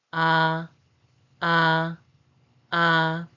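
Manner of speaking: "Ah" held long on a level pitch; separate, drawn-out "ah" for learners to copy; vowel demo. Shown as a demonstration. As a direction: say "ah" as a steady open vowel, evenly repeated. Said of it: "The pronunciation is".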